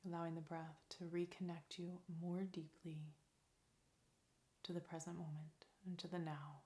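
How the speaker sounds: soft, calm meditation voice with long pauses; guided meditation. Real, not acted. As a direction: lead them gently into stillness, leaving space between phrases.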